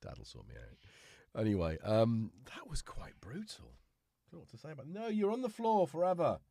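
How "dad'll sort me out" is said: lightly voiced grumpy cat; voicing the cat. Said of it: "Tom was just like".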